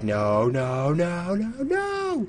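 rising into a very high squeak; hammed-up rising squeal; mock panicked squeal. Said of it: "I'd be like".

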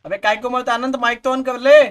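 loud call. Real, not acted. Gamer yelling at teammate Anand to turn his mic on, loud. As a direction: yell at him to switch the mic on. Shouting at friend about mic.

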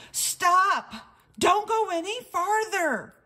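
loud, high warning cry; urgent shout. Acted. Squirrel warning Wordy Birdie away from danger, shouting. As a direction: yell stop to save her.

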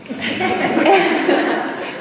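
breathy airless laugh, falling away. Real, not acted. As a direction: let a fond laugh slip out. Remembering mom's loving words.

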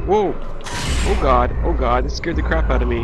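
loud, excited gaming shouts; excited gamer. Real, not acted. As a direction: hype yelling at the ghost. Ghost fight, loud yelling.

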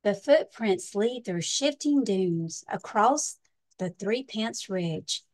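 lively storybook read-aloud with big swings; reading to children. Acted. Librarian reading a pirate picture book to children, lively storytime voice. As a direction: read it like storytime for little kids.

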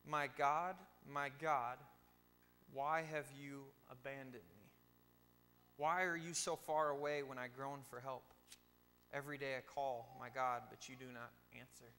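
loud, declaiming the psalm aloud; loud scripture reading. Shown as a demonstration. Preacher reading Psalm 22 aloud to his congregation, loud declaiming. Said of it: "It goes like this. Ready?".

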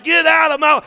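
high, loud imitation of a worshiper pushing through; imitated urgent shout. Preacher imitating the girl who kicked her way through, urgent shout. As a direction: shout it as if shoving past someone. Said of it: "Like, she's like".

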